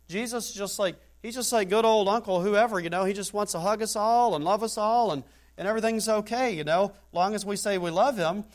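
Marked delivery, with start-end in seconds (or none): none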